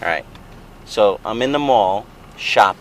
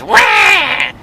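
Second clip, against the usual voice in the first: high, loud mock baby wail; 7 dB louder than usual. Dad doing the baby, a high loud mock wail. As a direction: wail like a screaming infant, way up high. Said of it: "the baby goes".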